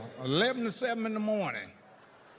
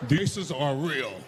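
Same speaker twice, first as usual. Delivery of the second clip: low, slow put-on voice with a laugh; mock low voice; 5 semitones lower, much slower than usual. Comic playing a character bragging, low and slow. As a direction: drag it out deep and amused.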